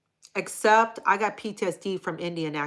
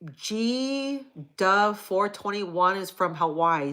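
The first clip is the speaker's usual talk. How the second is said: normal talking voice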